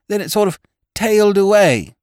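high, breathless, fluttering housekeeper voice; a flustered old servant in a state; agitated housekeeper. Acted. Housekeeper bursting in on the doctor, high and agitated.

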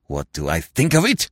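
angry low roar. Acted. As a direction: bellow back in rage. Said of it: "roared Ganimard".

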